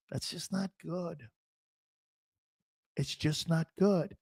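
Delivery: lightly voiced slow, soft pundit; a hint of a somber analyst; John McMullen impression. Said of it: "I'll do a John McMullen".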